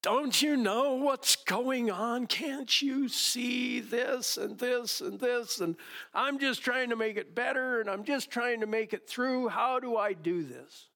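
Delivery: frustrated plea. Retold or imitated. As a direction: plead like you're at the end of your rope. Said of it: "I'm frustrated 'cause I'm like".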